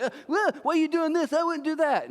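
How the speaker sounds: high, whiny, prissy mock critic. Critics questioning your choice, whining way up high. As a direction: whine it way up high like a scold. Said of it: "People wanna come at you like".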